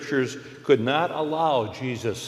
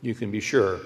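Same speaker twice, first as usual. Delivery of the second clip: ordinary delivery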